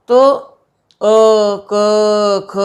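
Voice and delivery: अ, क, ख voiced slowly from the throat, one at a time; sounding throat letters. Shown as a demonstration. Teacher shows students throat sounds, each letter clearly sounded. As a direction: let अ, क, ख come straight out of the throat. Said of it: "अब इन वर्णों के उच्चारण करके आप देखेंगे".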